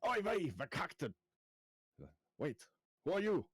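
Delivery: high-pitched startled exclamation, slowing on the curse; startled outburst. Acted. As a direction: jolt awake in alarm, pitch leaping up. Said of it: "kinda jumps up".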